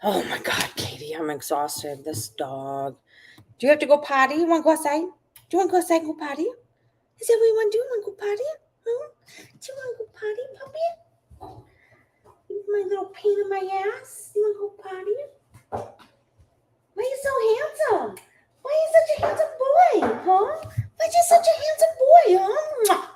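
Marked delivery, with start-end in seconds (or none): 3.6-15.2 high puppy talk. Real, not acted. Asking her puppy to go potty, squeaky baby talk. Asks dog about potty. 16.9-23.1 gushing puppy talk. Real, not acted. Praising her handsome dog in squealing baby talk.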